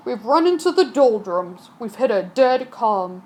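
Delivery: put-on low, gruff old sailor voice; a grumbling sea captain. Captain telling the crew they are becalmed.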